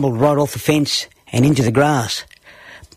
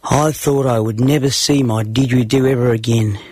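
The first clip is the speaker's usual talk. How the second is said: plain reading voice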